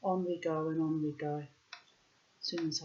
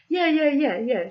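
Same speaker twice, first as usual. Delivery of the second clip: high, loud run of yeses; imitating an overkeen friend; eager insistence; 10 semitones higher, 12 dB louder than usual.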